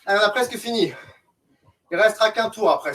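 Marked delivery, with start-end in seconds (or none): none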